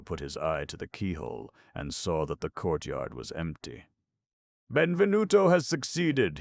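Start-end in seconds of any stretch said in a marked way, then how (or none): 4.7-6.4 high, loud joyful cry; excited cry. A narrator's reading. Ascanio to Aubry, crying out in triumph. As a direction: cry out the good news, thrilled. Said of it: "he cried".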